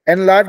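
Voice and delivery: एनलार्ज with a loud, full "en" at the start; over-open first vowel. Shown as a demonstration. Teacher showing what not to say, एनलार्ज with a loud open EN. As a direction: hit a clear open EN in एनलार्ज. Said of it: "एकदम loud एन नहीं है वो".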